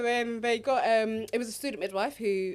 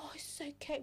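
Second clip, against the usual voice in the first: soft, higher-pitched cooing; soft soothing midwife; 6 semitones higher, 12 dB quieter than usual. Acted. Mimicking the too-gentle midwife, soft and soothing. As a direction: soothe gently in a small high voice. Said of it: "I don't need someone who's gonna be like".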